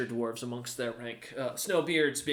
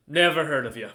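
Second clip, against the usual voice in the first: loud, blunt guard's brush-off; dismissive guard; 7 dB louder than usual. Acted. Guard rebuffs the self-named Ironheart merchant, loudly dismissive. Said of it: "he looks up at you and goes".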